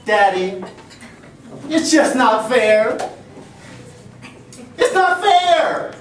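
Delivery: put-on high, loud whining little girl; whiny child. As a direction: whine it like a wronged kid. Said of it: "my daughter Emily speaking".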